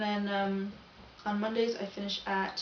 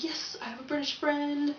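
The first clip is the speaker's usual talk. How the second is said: higher-pitched, thrilled inner cheer; playfully triumphant, pitch lifted; gleeful mock exclamation; 6 semitones higher than usual. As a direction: act out delight at a new friend. Acting out delight at new friend.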